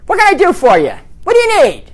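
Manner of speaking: mock East Coast brusqueness; unfriendly East Coast. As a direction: bark the questions like an impatient clerk. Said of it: "they don't sound so friendly".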